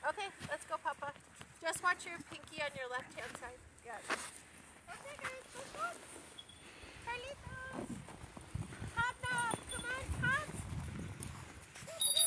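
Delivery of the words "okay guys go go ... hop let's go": higher, sing-song animal call. Real, not acted. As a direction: coax the goats along cheerfully. Calling goats onward.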